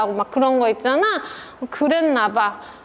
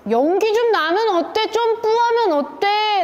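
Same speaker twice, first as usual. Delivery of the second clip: raised-pitch, drawn-out imitation of a brush-off; dismissive retort; 7 semitones higher than usual. Husband brushing off wife's worry about smoke, dismissive. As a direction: throw it back as a provoking question. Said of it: "제 안에 어떻게 들렸냐면요".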